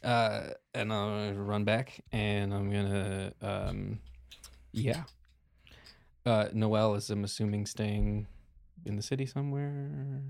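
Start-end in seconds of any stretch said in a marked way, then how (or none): none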